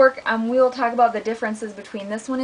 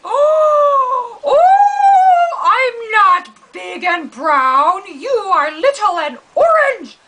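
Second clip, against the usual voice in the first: much higher, louder crying cartoon cat; wailing cat; 11 semitones higher, 8 dB louder, much slower than usual. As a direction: howl the insult back. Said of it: "the dog and the cat".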